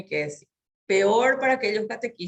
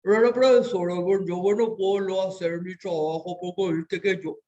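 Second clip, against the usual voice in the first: mock mumble, as if a hot potato in the mouth; mushy, mumbled teen speech; mumbling teenager. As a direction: imitate a student who swallows his words. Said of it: "una papa caliente en la boca".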